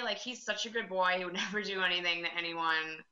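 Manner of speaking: ordinary talk, nothing marked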